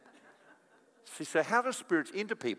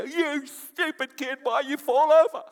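high, loud, slow scolding yell; a harsh parent yelling at a fallen kid; mock angry parent; 13 semitones higher, 11 dB louder than usual. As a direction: scold the child hammed-up. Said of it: "You don't go".

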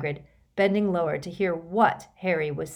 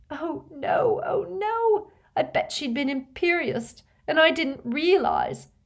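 very high, crying voice; renewed sobbing; 10 semitones higher, 3 dB louder than usual. A narrator's reading. Leanne realizing Katie was bewitched, sobbing. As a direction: wail in dawning horror. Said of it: "Leanne shook with renewed sobs".

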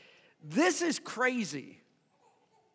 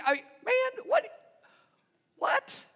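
high squeak of disbelief; incredulous; 13 semitones higher than usual. Acted. Preacher repeating Jesus' reply, sputtering disbelief. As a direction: sputter like you can't believe it.

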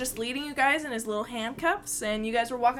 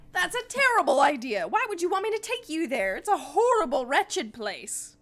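higher-pitched, agitated protest; appalled kobold; 7 semitones higher, 3 dB louder than usual. Acted. Kobold objects to guiding the party, appalled. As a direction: object in a raised creature voice.

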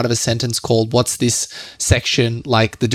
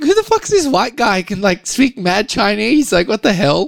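incredulous high outburst; 8 semitones higher than usual. Retold or imitated. Shocked Chinese locals reacting.

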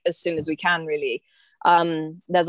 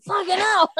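high, squealed mock swear; hammed-up curse; 13 semitones higher than usual. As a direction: blurt it out. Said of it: "rather than".

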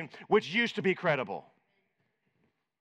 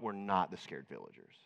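correcting himself; 11 semitones lower, 10 dB quieter than usual. Real, not acted. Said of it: "actually, you know what".

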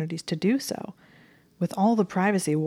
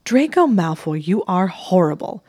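indignant; 2 semitones higher, 7 dB louder than usual. A narrator's reading. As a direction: burst out in outrage. Hermione indignant outburst.

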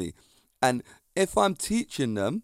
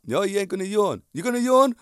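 challenging taunt; 6 semitones higher, 3 dB louder than usual. Pretend. Challenging an imagined yawning trainee.

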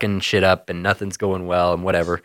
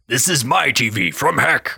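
devil voice; 4 semitones higher than usual. Retold or imitated. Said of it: "he's like".

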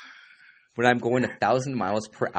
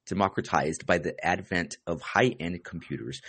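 normal talking voice

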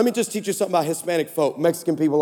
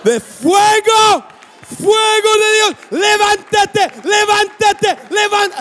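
high, loud shouted Spanish prayer; 11 semitones higher, 6 dB louder, slower than usual. Retold or imitated. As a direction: belt out the Spanish prayer, slow and fierce. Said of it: "she goes".

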